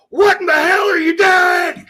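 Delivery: slow, very high, loud outraged shout; re-enacted yell. Fan re-enacting his bar outburst, high shout. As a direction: yell it at the screen in disbelief. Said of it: "and I yell".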